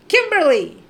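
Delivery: shouted name across the airport, high and loud; calling out for her foster sister, super loud. Retold or imitated. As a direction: call out her name as loud as you can. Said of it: "like, super loud".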